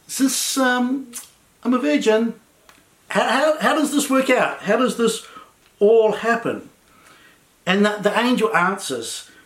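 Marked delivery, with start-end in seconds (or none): 0.1-6.7 high, halting, puzzled questioning; acted bewilderment. Mary asks the angel how this works, hesitant and baffled. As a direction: stammer through it, imitating a baffled girl.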